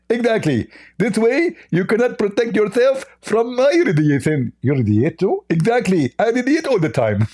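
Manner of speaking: high, squeaky mock voice; a hammed-up high caricature voice; mocking Zakir Naik. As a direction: lampoon the opponent in a silly high pitch.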